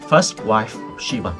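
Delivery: ordinary delivery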